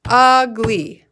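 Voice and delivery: loud, clapped out in two beats; clapping syllables. Shown as a demonstration. Teacher leads the class clapping out "Ugly". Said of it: "Let's do it together".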